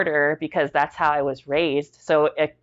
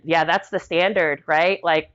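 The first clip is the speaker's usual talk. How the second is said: normal talking voice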